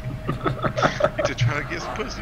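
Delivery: laughing through the words; breaking up laughing mid-sentence. Real, not acted. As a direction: still giggling while telling.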